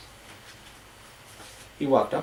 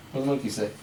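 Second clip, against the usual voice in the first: ordinary delivery, nothing marked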